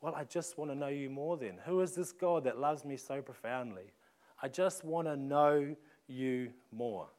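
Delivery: soft, earnest inner voice of Paul's heart; longing prayer. Retold or imitated. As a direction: breathe it like a quiet prayer. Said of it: "his heart's response".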